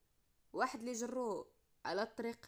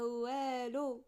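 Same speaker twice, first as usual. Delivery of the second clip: drawn-out dismissal; 4 dB louder, much slower than usual. Acted. Friend dismisses, stretched word.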